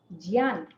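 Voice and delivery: ज्ञान said as "jyaan", soft j plus y; mispronounced as jyan. Shown as a demonstration. Teacher demonstrates another wrong way, ज्ञान as "jyaan". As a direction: say ज्ञान low, opening on "jy". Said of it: "ये भी सही नहीं है".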